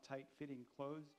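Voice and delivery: plain reading voice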